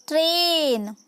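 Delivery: ट्रेन with a long, drawn-out "ay"; slow phonics demo of ट्रेन, "treeh-n" with stretched A; long A sound. Shown as a demonstration. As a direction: hold the vowel in ट्रेन before closing on n. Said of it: "वो भी long sound को".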